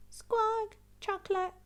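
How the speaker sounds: high, loud squawking parrot; Polly parroting the shopping order, squawky. Acted. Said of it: "Polly repeats".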